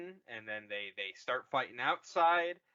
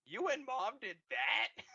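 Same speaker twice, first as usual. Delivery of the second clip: much higher, whining half-cry; mock Kylo whine; 9 semitones higher than usual. Kylo asking Han, whining mock shock.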